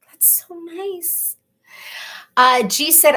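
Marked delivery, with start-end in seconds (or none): 0.1-1.4 slow, much softer, whispered, near tears; tearful whisper. Retold or imitated. When someone says something nice, whispering while near tears. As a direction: breathe it out, moved almost to crying. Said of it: "and I'm like".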